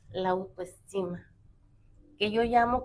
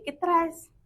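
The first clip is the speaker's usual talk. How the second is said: put-on higher, prying older sister; questioning sister; 7 semitones higher, 3 dB louder than usual. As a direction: ask like a sister who knows something's up. Sister questions crying girl.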